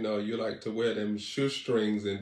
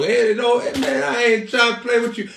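high, loud hammed-up scolding; loud playful rant at the audience; mock scolding shout; 10 semitones higher, 10 dB louder than usual. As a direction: yell at the viewers in mock outrage.

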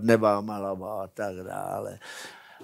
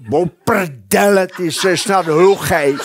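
annoyed yell; 5 semitones higher, 7 dB louder, slower than usual. Retold or imitated. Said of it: "bouchne do stolu a zařve".

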